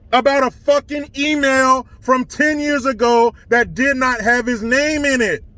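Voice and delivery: ordinary delivery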